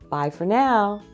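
ordinary delivery, nothing marked

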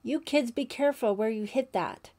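a counselor scolding campers from afar; calling out. A narrator's reading. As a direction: call out to the kids. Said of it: "Sandy called".

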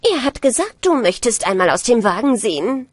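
a lightly voiced scheming old woman; old stepmother lying to the bride, a hint of a sly crone; old witch lying. A narrator's reading. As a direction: slip the lie in like a sly old hag. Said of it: "sprach die Alte".

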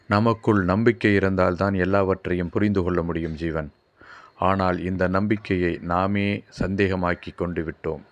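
ordinary delivery

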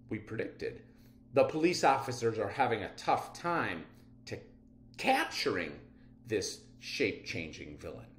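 usual speaking voice

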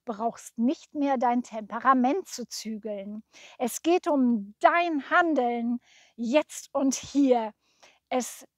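emphatic, measured verse reading; poem recitation. Acted. Painter reciting a soul poem for the person it was painted for, rousing recitation. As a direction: recite it line by line with stirring drive.

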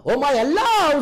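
high, loud cry of wonder; loud exclamation. Acted. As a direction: exclaim to God. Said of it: "ऐसा नहीं बोला".

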